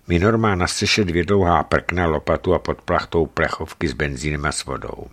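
ordinary delivery

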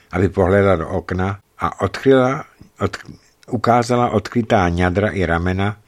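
usual speaking voice

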